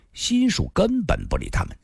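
ordinary delivery, nothing marked

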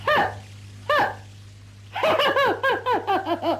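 鼻子里哼出两声冷笑; 元帅不屑地冷笑. Acted. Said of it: "一阵冷笑". (徐达听了胡话，冷笑一声)

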